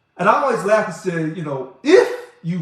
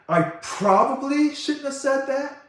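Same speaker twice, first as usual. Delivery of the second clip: slow, much higher mocking imitation of her apology; mocking quote; 9 semitones higher, slower than usual. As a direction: sneer it back slowly in a put-on high voice. Mocking Teresa Taylor's apology.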